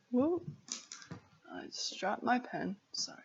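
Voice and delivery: ordinary delivery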